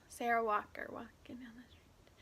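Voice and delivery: whispered aside. Real, not acted. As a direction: breathe it as a whisper. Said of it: "That's making me do".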